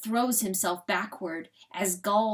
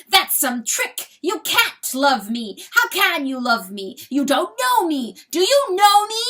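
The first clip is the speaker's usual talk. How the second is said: loud accusing shouts, climbing higher; outraged cry; 7 semitones higher, 10 dB louder than usual. A narrator's reading. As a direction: build from angry protest to high yell. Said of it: "cries Chanticleer".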